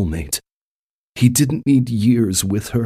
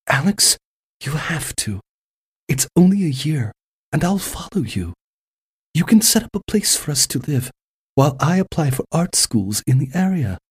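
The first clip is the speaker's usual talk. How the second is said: put-on lightly higher, gentle young woman; 7 semitones higher than usual. A narrator's reading. Female lead voiced by reader.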